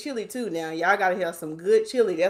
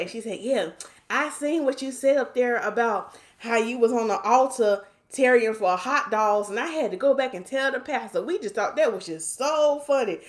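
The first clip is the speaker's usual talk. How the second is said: ordinary talk, nothing marked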